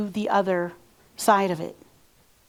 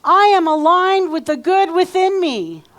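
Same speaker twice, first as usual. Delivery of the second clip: uplifted affirmations; 6 semitones higher, 4 dB louder than usual. Shown as a demonstration. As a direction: declare it with your whole soul. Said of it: "Put your energy into this".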